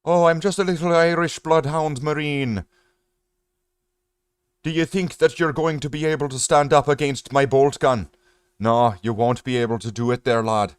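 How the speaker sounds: put-on jokey Irish accent, taunting the enemy; mock Irish accent. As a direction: play a cocky Irish marine taunting, in a fake Irish accent. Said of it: "Does that make him Irish?".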